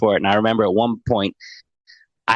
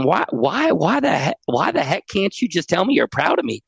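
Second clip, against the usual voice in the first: put-on pleading kid; 8 semitones higher than usual. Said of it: "one last ditch of sort of youthful vulnerability".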